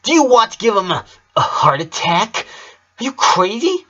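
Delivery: furious scolding. Acted. Lynn berates Lucy, high fast furious scolding. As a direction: let her have it. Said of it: "she yelled at Lucy".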